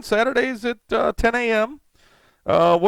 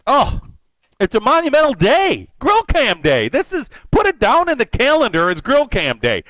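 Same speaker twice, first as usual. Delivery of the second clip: higher, louder proud announcement; excited; 6 semitones higher, 3 dB louder than usual. Real, not acted. Streamer declaring grill cam day to his chat, higher and louder with excitement. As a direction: proclaim it like a holiday.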